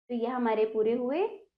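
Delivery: ordinary talk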